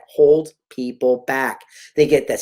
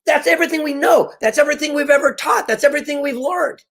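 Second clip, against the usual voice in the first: much higher, pitched-up disbelief; incredulous protest; 11 semitones higher than usual. Retold or imitated. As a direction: protest like you can't believe what you just heard. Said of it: "I go".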